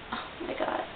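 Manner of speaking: whispered in dismay; hushed gasp of sorrow; stunned whisper. Real, not acted. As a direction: let it out as a breath. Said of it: "reacting to sad scene".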